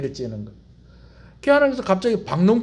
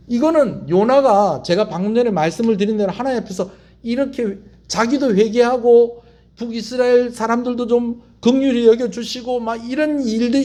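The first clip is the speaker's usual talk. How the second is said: ordinary delivery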